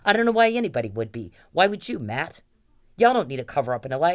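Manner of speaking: a real outburst at the judge and Matt; real anger. Real, not acted. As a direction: lay into him with real outrage. Furious rant at judge.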